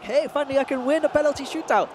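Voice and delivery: mock European accent, not quite sure which country; bad European accent, cheery and high; terrible European accent. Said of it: "I don't know what that accent was".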